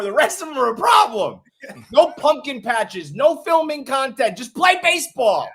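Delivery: high-pitched, emphatic demands; exasperated rant. Real, not acted. Host ranting about players' wives, high exasperated demands. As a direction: lay down the rules, pitched well up.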